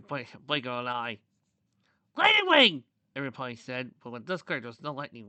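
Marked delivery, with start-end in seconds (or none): 2.2-2.8 high, loud exclamation; crowd's cry. A narrator's reading. Everybody calling out to Lightning Wing, high alarmed shout. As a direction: shout the name in alarm, pitched way up.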